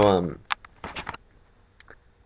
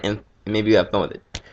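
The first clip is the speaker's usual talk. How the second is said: ordinary delivery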